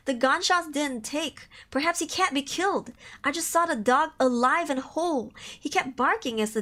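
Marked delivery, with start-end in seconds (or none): none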